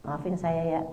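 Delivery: low, soft, heavy-hearted sorry; reluctant apology. Acted. Wife asks husband's forgiveness with a heavy heart, low and subdued. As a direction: apologise quietly against your own pride. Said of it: "walaupun berat hati".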